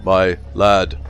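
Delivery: a hint of a rattling, monotonous constable; lightly voiced, flat and expressionless cockney copper; death-rattle policeman. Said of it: "voice like a prolonged death rattle".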